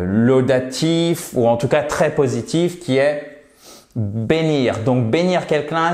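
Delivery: ordinary talk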